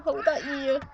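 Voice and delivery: ordinary delivery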